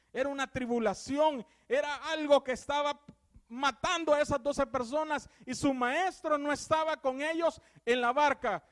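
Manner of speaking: loud, high fervent preaching; preaching at full force. Acted. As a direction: build the storm scene like a pulpit climax. Preaching storm passage.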